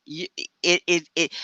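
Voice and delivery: ordinary talk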